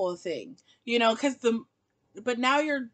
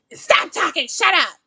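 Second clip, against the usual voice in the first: loud, higher snapping quote; snappy mock shout; 6 semitones higher, 7 dB louder than usual. Insecure boyfriend snapping at his girlfriend, mock shout. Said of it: "He's just like".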